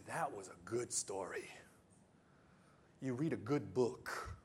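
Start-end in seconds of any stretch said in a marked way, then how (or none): 0.0-1.5 hushed whisper of wonder; whispered awe. Retold or imitated. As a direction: breathe it out in a whisper. Quoting viewer after good movie.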